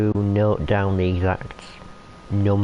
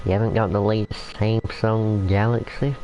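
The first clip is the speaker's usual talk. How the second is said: mocking, incredulous put-on American accent; a teasing American accent, as if shocked at an old phone; American accent, lightly hammed-up disbelief; 2 semitones higher than usual. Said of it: "I don't know why I'm using an American accent".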